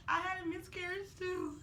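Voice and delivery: high voice breaking with tears; retold words squeezed out tearfully; crying. Mother quoting the nurse's confession, crying. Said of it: "Why are you crying?".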